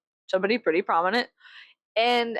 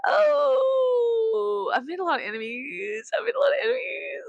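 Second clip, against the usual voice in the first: high, hammed-up wail; fake crying; 12 semitones higher than usual. Speaker lamenting enemies made online, fake crying. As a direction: wail the words in mock despair.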